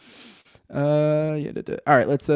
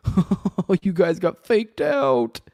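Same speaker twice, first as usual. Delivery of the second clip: higher, drawn-out prankster's gloat; mocking taunt; 4 semitones higher, much slower than usual. Prankster gloating to those who fell for it, mocking. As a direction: drag it out like a jeering prankster.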